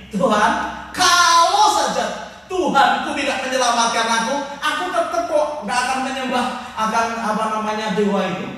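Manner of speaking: loud, bold declaration to the king; faith-filled cry. Acted. Faithful man shouting before the king, loud and bold. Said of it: "Dia berkata kepada, kepada Baginda begini".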